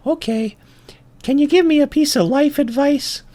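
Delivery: very high-pitched ant voice; little ant. Acted. Ant asking the bull for advice, high little voice. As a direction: ask it like a tiny, hopeful ant. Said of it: "And he says".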